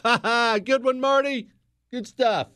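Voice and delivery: high-pitched, hearty fake laugh and praise; hammed-up jovial approval; chummy laughing boss. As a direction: play the boss cracking up at a favorite's joke. Boss fawns over top salesman's joke.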